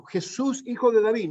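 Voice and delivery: a blind beggar shouting for help; desperate cry. Retold or imitated. Blind beggar crying out to Jesus, desperate. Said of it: "lo gritaba de tal manera".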